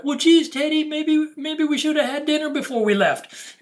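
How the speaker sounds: put-on high little-kid voice talking to a teddy; child voice. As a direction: play a small child fretting to the teddy. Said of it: "an eight-year-old standing on the corner".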